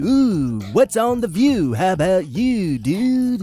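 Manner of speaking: sing-song drawn-out mock folk singer. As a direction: swoop up and down, stretching the words. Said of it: "it's not a [name] song, but it could be".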